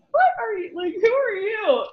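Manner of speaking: high, puzzled what-are-you; mock baffled at husband's new accent; bewildered question. Wife reacts to husband's drawl.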